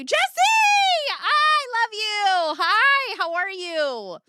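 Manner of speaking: high, loud, slow squealing hello; high-pitched gushing hi; excited greeting. Real, not acted. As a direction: gush over a favourite viewer.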